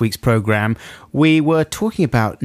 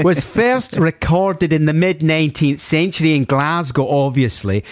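put-on Glaswegian, rising higher; Glaswegian accent; 4 semitones higher than usual. Host reading listener's etymology, Glaswegian accent. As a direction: read it in a broad mock-Glasgow accent. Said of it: "I should do the correct accent".

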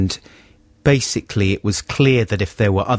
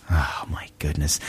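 exasperated whisper; 6 semitones lower, 8 dB quieter than usual. Retold or imitated. Exasperated aside.

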